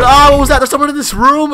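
high, loud startled cry; startled alarm. Pretend. Voicing a parent who finds someone in the room, startled.

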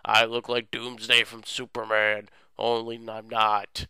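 slow, drawn-out boasting villain voice; lazy and slow, playing the big bad; mock villain. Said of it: "he was all like".

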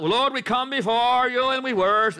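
hammed-up formal churchy prayer, high; mock the by-the-book worshipper; mock stiff prayer. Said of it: "this is not".

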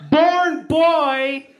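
high, loud, drawn-out repeat; mock yelling. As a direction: push each word at mom to get through. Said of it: "You're just yelling at each other".

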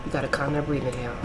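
low, soft shushing; calm a panicking friend under your breath; hushed soothing. Real, not acted. Hushing and calming scared film girl.

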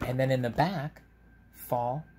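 usual speaking voice

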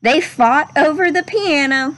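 gentle, measured storybook read-aloud; unhurried classroom story reading voice; reading to children. Acted. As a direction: read it like a picture book to a class. Teacher reads picture book aloud.

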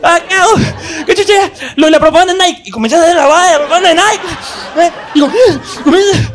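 put-on boy suddenly marked, frantic and laughing; panicked. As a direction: panic about being marked. Panicked high voice while marked.

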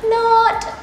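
high sarcastic "not". Pretend. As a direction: undercut the joke with a high quip. Undercutting own joke.

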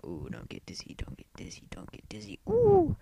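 very soft whispered chant, ending in a high ooh; weird playful whisper. Pretend. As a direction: whisper it like silly hypnosis, rising to a squeaky ooh. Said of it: "I probably sound weird".

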